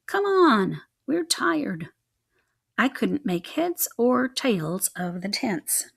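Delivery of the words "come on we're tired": put-on high, whiny children's complaint; whining kids. A narrator's reading. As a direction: whine it like tired little mice. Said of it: "the little mice began whining".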